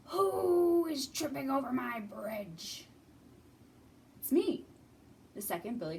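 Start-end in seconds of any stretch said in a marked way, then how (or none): 0.1-2.9 slow, louder troll growl; menacing troll voice; mean troll. Acted. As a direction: loom over the bridge and demand it. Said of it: "a mean troll".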